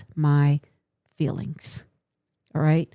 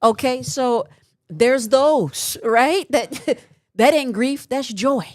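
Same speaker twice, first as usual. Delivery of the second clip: higher and louder, slow punchy delivery; rowdy comic rant; 6 semitones higher, 6 dB louder than usual. Real, not acted. Mocking relatives nobody mourned, loud and laughing. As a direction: roast them with a laugh.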